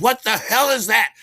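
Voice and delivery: loud, high challenge; mock outrage. Dealer confronting his buyer over the bill, mock outrage. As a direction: demand it in loud disbelief.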